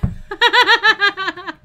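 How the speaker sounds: big real burst of high laughter; loud helpless laugh. Real, not acted. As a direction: howl with laughter. Cracking up at riddle.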